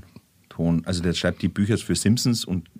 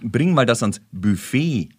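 langsam, mit BUFFET hinten betont; gedehnt, korrekt gesprochen; slower than usual. Shown as a demonstration. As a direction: zieh den Satz bis zum langen Ende von BUFFET. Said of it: "Wenn du sagst". (BUFFET korrekt und gedehnt, Betonung hinten)

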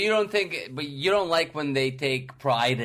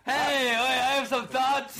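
high, slow objector voice; raised voice, drawn out; objecting wedding guest; 8 semitones higher, slower than usual. Pretend. Objecting in wedding role play.

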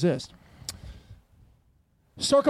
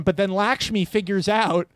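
usual speaking voice

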